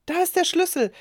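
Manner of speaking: high, loud relieved call; relieved cry. A narrator's reading. Girl finds the lost key and calls out relieved. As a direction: call out, glad to find it. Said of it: "rief Clara erleichtert".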